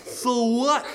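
high, insolent question; bratty retort. Acted. Cursed girl answering the grateful bear, high bratty retort. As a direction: toss it out high and careless.

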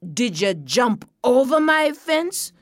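farmer's mounting suspicion; interrogating farmer. A narrator's reading.

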